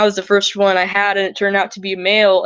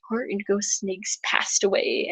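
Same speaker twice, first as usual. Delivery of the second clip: whispered, gentle bad news; hushed whisper; 8 dB quieter than usual. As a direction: breathe it out like a secret. Zookeeper breaking sad news softly.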